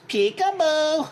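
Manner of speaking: high, odd toy voice chirping peekaboo; talking toy voice. Retold or imitated. Brother's Elmo doll greeting the light, high toy voice. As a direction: play the creepy light-activated Elmo doll. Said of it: "it would go".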